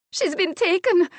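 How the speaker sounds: very high, loud cry of distress; desperate gasping. A narrator's reading. Maid tells of her mistress's capture, desperate. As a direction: cry it out desperately, near tears. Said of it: "her breath coming in desperate gasps".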